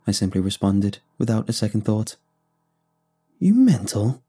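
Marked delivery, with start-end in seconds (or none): 3.4-4.2 mildly raised, incredulous challenge. A narrator's reading. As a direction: throw it out as a sharp, acted challenge. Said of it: "Brom raised his voice".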